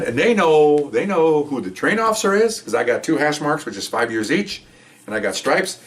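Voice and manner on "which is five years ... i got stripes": ordinary talk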